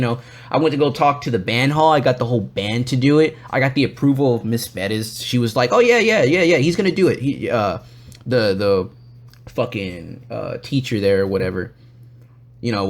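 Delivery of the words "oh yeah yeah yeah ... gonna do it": imitating a high, breezy promise; mock over-agreeable reply; eager assurance. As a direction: wave it through with high yeahs.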